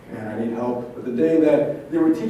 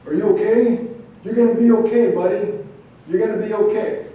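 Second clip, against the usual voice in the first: raised, higher urgent reassurance; medic shouting; 6 semitones higher, 4 dB louder than usual. Retold or imitated. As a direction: call out to a wounded soldier. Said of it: "some medic shouting".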